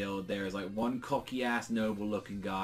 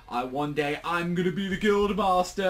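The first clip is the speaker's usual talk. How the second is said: loud, high-pitched mock bragging; cocky boast; 7 semitones higher, 7 dB louder than usual. Cocky new member boasting about his future, mock grandiose. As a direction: build to a big self-important declaration.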